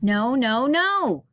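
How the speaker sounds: high, loud shouted no; girl shouting at her parrot. Acted. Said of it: "shouted Mara".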